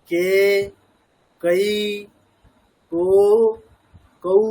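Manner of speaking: each syllable from ক to কৌ isolated and held, long pauses between; very clear syllable drill. Shown as a demonstration. Teacher modelling vowel practice for learners, ক to কৌ drilled distinctly. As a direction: chant ক through কৌ one by one, every vowel pure and full. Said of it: "মানে স্পষ্ট করে একেবারে".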